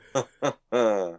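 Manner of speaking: short run of chuckles you know you shouldn't let out; laughing. Real, not acted. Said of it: "Why am I laughing at this".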